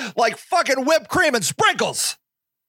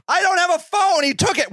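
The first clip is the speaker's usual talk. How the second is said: desperate woman; 7 semitones higher than usual. Retold or imitated. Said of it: "the woman pleads".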